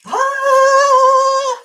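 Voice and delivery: sudden high squeal of delight; a loud, high-pitched squeal of joy; excited squeal. Real, not acted. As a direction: squeal as the award is revealed. Curtain drops revealing award.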